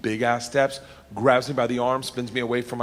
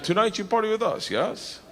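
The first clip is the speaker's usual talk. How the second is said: put-on higher, coaxing Russian accent; Russian cop; 7 semitones higher, 3 dB quieter than usual. Cop repeats invitation to baffled student, higher Russian accent. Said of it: "He's like".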